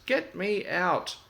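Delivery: trapped engine begging for rescue; pleading cry. Acted. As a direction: drag out the demand in distress. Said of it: "cried Thomas".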